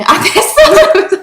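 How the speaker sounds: 높고 크게, 길게 끌며 됐다고 뿌리치기; 장난스럽게 과장한 퇴짜 흉내; 과장된 거절 재연. Retold or imitated. 거절하던 말을 웃으며 재연. (느리고 높은 거절 흉내)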